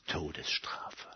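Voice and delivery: slow, hushed whisper; whispered sentence. Acted. Satan names the penalty to Jesus, slow and whispered. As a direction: whisper the verdict, drawn out.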